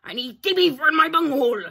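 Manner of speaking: high-pitched demanding alien voice; Cornholio. Acted. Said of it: "I am Cornholio".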